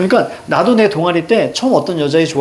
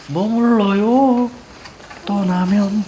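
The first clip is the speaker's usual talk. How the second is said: [name] impression; 3 semitones higher, 4 dB quieter, much slower than usual. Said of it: "[name] 다 흉내냈었는데".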